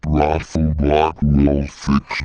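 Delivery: broken speech processor begging for help; degrading voice. Pretend. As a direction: let the voice wind down like a dying machine. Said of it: "starting to sound unintelligible".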